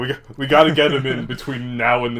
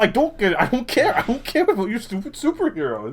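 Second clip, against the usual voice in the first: high-pitched playful protest; hammed-up dismissive retort; mock exasperation; 7 semitones higher than usual. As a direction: insist it, voice raised high. Mock quarrel about superheroes.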